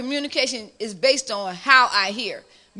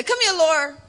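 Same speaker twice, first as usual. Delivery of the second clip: curt tone; 2 semitones higher than usual. Shown as a demonstration. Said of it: "it's a tone of the voice".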